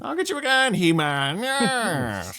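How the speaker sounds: high, loud cackling villain; cartoon villain voice. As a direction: shriek it like Skeletor. Cartoon villain voice quoting Skeletor.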